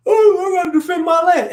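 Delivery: high, mocking voice of a settler; mock protest. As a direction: squeal it like a whiny landowner.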